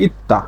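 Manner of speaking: ইত্তা with a clear double t; changed consonant. Shown as a demonstration. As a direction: say ইত্তা, t replacing d. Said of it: "উচ্চারণ হচ্ছে". (ইত্তা, জোড়া ত দিয়ে উচ্চারণ)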